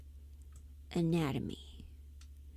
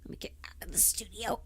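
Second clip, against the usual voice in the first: whisper; 2 semitones higher, 7 dB quieter, faster than usual. Real, not acted. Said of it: "whispering aside".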